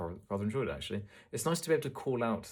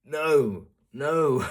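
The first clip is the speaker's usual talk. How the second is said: emphatic no; 6 semitones higher, 11 dB louder than usual. Real, not acted. Rejecting the pushy sales approach.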